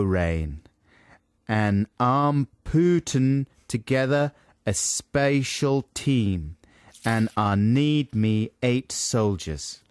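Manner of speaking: slow, halting Tennessee drawl sounded out; phonetic Tennessee accent. Acted. Co-host reads Brad Pitt's line phonetically, Tennessee accent. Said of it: "read this out phonetically".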